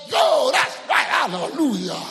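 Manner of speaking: high, loud hallelujah shout; mock jubilant shout; excited shout. Retelling GO jumping up, shouting.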